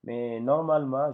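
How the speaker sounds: ordinary talk, nothing marked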